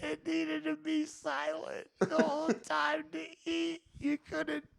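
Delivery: high, soft, helpless laughing voice; talking through laughter. Real, not acted. Son recalling dad's rage at chewing, real helpless laughter. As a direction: squeeze the words out while cracking up. Cracking up retelling dad's rule.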